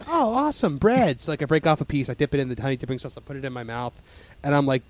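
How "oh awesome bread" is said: higher-pitched happy exclamation; an eager "ooh, goody" lift; mock excited reaction. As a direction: re-enact pleased anticipation, pitched up. Acting out eager first reaction to bread.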